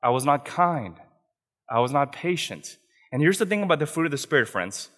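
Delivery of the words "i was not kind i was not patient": normal talking voice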